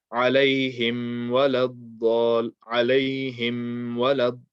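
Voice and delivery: "عليهم" with the final m held and hummed before "ولا"; slight wrong ghunna. Shown as a demonstration. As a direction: linger nasally on the Mim of "عليهم". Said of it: "hold on to the meme for a bit".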